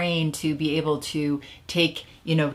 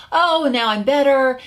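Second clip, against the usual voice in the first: higher, louder mock relief; relieved self-talk; 9 semitones higher, 7 dB louder than usual. Smoker who feels recovered talking themselves into it, mock relief. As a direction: act out a carefree "all better now". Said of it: "They forget".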